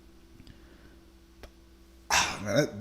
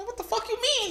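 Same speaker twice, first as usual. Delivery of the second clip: much higher, stunned disbelief; shocked question; 3 dB quieter than usual. Retold or imitated. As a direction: jump up in pitch, baffled. Retold shock at cousin's news.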